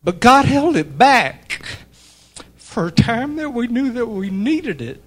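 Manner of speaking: fighting back tears as he speaks; getting emotional. Real, not acted. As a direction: voice high and loud, choked with real emotion. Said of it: "I'm getting emotional".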